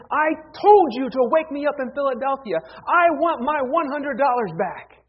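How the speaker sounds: hammed-up angry passenger; irate. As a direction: confront the conductor, furious. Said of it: "this guy is irate".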